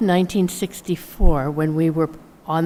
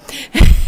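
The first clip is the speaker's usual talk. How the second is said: laugh; 9 dB louder than usual. Real, not acted. Laughs after teasing.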